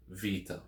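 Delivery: Vita said VEE-tah with a V as in vase; Vita in modern Greek. Shown as a demonstration. Teacher demonstrating modern Greek Vita, V sound. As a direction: model Vita with a soft V, not beta. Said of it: "V as in vase".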